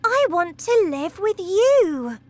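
put-on girl begging her teacher; pleading girl. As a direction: beg her teacher earnestly.

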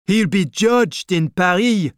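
high, projected heckle from a crowd; calling out. A narrator's reading. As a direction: call it out over a crowd. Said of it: "another man called".

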